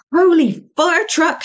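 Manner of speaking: a loud high outburst at the noise; shout. A narrator's reading. Varma shouts at the laughing officers, loud and high. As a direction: bellow it from the doorway. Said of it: "shouted Varma".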